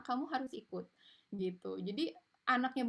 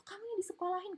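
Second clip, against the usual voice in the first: insulting parent; 11 semitones higher, faster than usual. Retold or imitated. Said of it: "Kayak misalnya ngatain".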